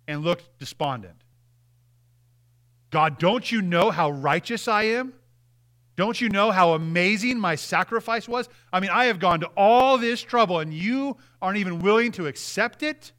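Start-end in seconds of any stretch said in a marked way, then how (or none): 2.9-13.1 loud, higher-pitched indignant protest as Cain; self-righteous, angry complaint. Acted. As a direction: act out an outraged man protesting to God, rising at the end. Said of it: "That sounds self-righteous, doesn't it? He's angry. He's mad".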